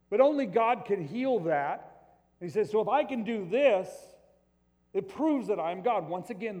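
loud, high preaching voice, driving each point home; fiery preaching. Acted. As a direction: preach it out to the whole room with full force. Preaching at full force again.

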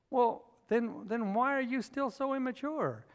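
usual speaking voice

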